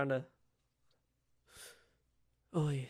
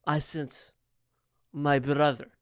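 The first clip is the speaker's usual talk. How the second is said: normal talking voice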